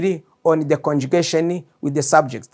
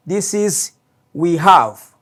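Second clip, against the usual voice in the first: ordinary delivery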